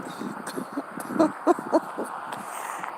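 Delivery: high, soft chuckle; real laugh. Real, not acted. Storyteller amused by her own impression, real soft laugh.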